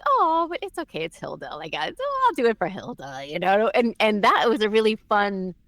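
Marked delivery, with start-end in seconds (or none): 0.1-3.2 high, indulgent forgiving coo; charmed fan. Retold or imitated. Fan won over by manipulative Hilda, indulgent coo. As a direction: forgive her because she's Hilda. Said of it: "and you're like".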